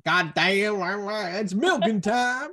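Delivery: loud, high Texan drawl; Texan accent. Retold or imitated. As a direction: ham up a loud Texan owner. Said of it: "a proper, like, Texan accent".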